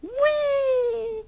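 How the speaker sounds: very high, loud delighted squeal; gleeful baby. Acted. Baby rabbit waking mid-fall, gleeful squeal. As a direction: squeal with joy.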